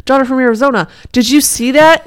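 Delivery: high, loud mock-dramatic father; exaggerated father calling to his daughter; hammed-up dad. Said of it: "the dad turns around and he's like".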